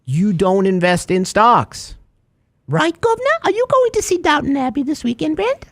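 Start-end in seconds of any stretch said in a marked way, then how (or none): 2.7-5.6 much higher, comic put-on character voice; playful high mock voice teasing a co-host. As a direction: ham it up in a high silly voice. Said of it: "I'm full of voices today".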